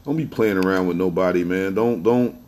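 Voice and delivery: ordinary talk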